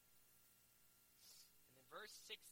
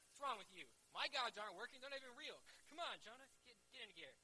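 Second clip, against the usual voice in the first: raised, louder mock berating; hammed-up exasperation, pitched up and urgent; exasperated berating; 6 semitones higher, 7 dB louder than usual. Captain urges Jonah to pray as gods fail, exasperated berating.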